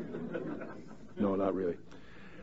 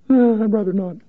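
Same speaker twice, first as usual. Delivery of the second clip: meek reluctant Job; 9 semitones higher than usual. Retold or imitated. Job's reply voiced jokingly.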